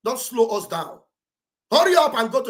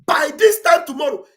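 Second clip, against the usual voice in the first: high, loud preacher cry on "this"; preaching prophecy; 7 semitones higher, 8 dB louder than usual. Retold or imitated. Speaker quoting Elijah's prophecy like a preacher, loud. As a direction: proclaim it again like a sermon. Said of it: "I feel like, you know, I'm in church now".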